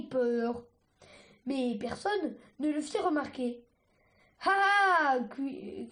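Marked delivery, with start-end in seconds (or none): 4.4-5.2 loud, high attacking yell; battle cry. Acted. Argile leaping at Sunny with a loud battle cry. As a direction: shout as you leap at her. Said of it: "cria Argile".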